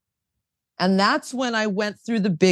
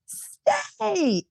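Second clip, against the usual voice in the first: excited echo; 14 semitones higher than usual. Retold or imitated. [name] echoes David's advice back, high and thrilled. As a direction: repeat the word much higher, thrilled and drawn out. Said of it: "And I was like".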